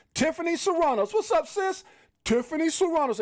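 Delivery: calling out her name, high and thrilled; excited shout-out. Real, not acted. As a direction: hail a favorite guest with high-pitched delight. Hailing a viewer who just arrived.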